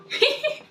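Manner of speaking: short high-pitched laugh; a quick bright giggle. Real, not acted. Laughs after the correction.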